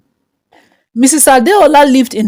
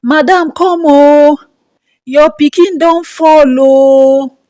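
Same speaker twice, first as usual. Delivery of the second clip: raised, drawn-out cry of alarm; screaming gate man; 5 semitones higher, 4 dB louder, slower than usual. A narrator's reading. Gate man alerting the mother to the fall, screaming. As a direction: call out high and slow, stretching each final "oh". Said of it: "the gate man screaming".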